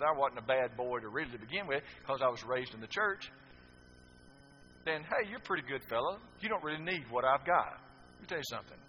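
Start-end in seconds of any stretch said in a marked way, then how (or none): none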